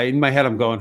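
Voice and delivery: ordinary talk, nothing marked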